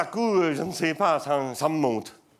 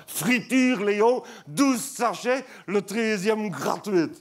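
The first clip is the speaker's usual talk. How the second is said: excited sales pitch; 7 semitones higher, slower than usual. Shown as a demonstration. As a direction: sell it like a thrilled announcer. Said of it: "Regarde-moi ça".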